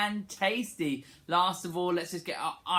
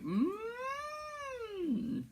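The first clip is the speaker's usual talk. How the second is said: sliding hum; 8 dB quieter than usual. Shown as a demonstration. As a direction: hum sliding up high and back down. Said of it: "Slide up really high and then really low".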